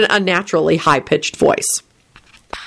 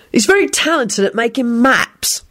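higher, innocent aside; innocent remark; 5 semitones higher than usual. A narrator's reading. William drops in the map remark, innocently. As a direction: add it with feigned innocence. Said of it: "William added innocently".

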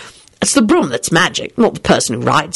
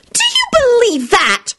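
high, slow outraged shout; slower than usual. Acted. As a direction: shout it after them. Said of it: "shouted Solange".

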